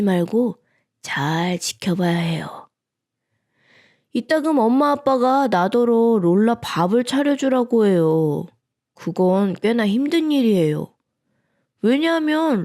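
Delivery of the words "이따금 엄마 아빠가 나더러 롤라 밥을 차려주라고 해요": ordinary delivery, nothing marked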